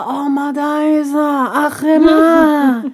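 high, drawn-out melodramatic wail; theatrical, slow lament; mock overwhelmed. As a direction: drag it out in hammed-up exasperation. Acting out being overwhelmed by messages.

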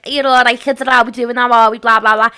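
loud, thick North Liverpool Scouse; hammed-up strong Scouse accent; really strong Scouse. Shown as a demonstration. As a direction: demonstrate the broadest Scouse at full volume. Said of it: "it becomes, like, really, really strong".

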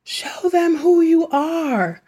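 high, slow mock scream of a mother; retold scream. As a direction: stretch it out like a mother crying out. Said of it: "T'Challa's mother was screaming".

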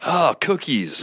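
high, drawn-out mock wail; Philip Rivers screaming. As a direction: play along with a hammed-up, high-pitched cry. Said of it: "you know, just screaming".

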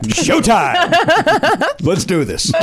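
high, bright showtime call; hype announcer. Acted. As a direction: pump up the room. Hyping up trivia segment.

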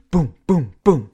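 three punchy, separate booms; imitated gunshots. Podcaster acting out Deadpool's three bullets, punchy gunshot booms. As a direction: hit each boom like a shot.

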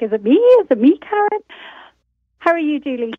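normal talking voice

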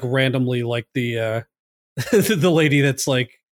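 ordinary talk

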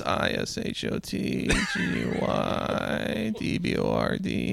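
slow, soft, mostly voiceless drawl; comic slow croak, each letter stretched; drawn-out spelling. Pretend. Spells handle in comic creaky drawl.

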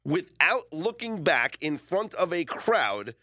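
slow, drawn-out quoting; deliberate, theatrical read-aloud; dramatic quote reading. Retold or imitated. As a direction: stretch each phrase of the statement for effect.

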